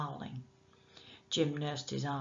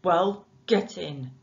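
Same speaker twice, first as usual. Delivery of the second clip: impatient; 8 dB louder, slower than usual. Acted. Nick ordering Jim.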